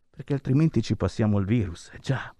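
ordinary delivery

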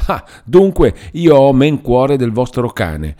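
wounded indignation, high and loud; indignant retort. Acted. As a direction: throw the reproach back at her, slowly. Uncle rebukes the weeping girl.

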